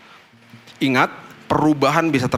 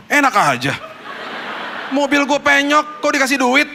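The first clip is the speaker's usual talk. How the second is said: mock indignation; 10 semitones higher than usual. Pastor protesting God's order to give money, mock indignation. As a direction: complain to God in comic outrage.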